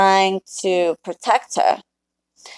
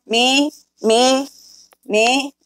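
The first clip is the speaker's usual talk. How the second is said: mock disbelief; 6 semitones higher, much slower than usual. Real, not acted. Incredulous at insult.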